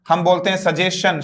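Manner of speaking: normal talking voice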